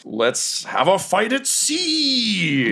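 high, slow, sing-song relish; gleeful announcement. Acted. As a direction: savor the coming battle. DM announces the battle.

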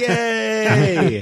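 loud happy yay; excited cheer. Real, not acted. Celebrates remembered word.

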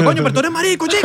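playful imitation of a father scolding; mock dad retort. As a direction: toss it off like an exasperated dad.

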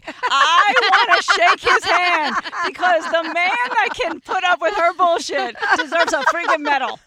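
loud, high, fired-up declaration; emphatic admiration. Real, not acted. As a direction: proclaim it like a mock tribute. Playful rant praising friend's partner.

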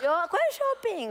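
mock offended, drawn out; indignant denial. Real, not acted. Runner denies shopping during race, indignant. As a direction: protest the joke with playful outrage. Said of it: "comprando shopping".

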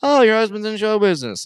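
1 mock polite interest. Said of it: "So I go".

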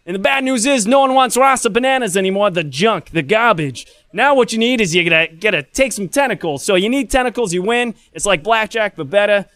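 high-pitched Jersey sales pitch; New Jersey accent. Acted. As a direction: pitch the game like a Brooklyn-Jersey hawker. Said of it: "this accent was not my idea".

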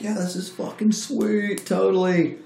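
high, gleeful buddy voice; imitating excited buddies. Buddies cheering the new contract, mock excited. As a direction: ham up the crew's delight. Crew celebrating the deal.

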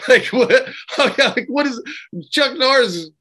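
can't get the question out for laughing; laughing disbelief. Real, not acted. Laughing at absurd fight prospect.

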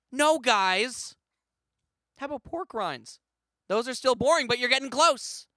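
overexcited ad host voice; cheesy snack commercial. Acted. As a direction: pitch the snack like a corny commercial. Fake snack ad read.

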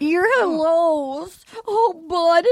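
slow, drawn-out tearful wail; milk it like an overwrought mother; mock weeping. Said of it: "so then she's weeping".

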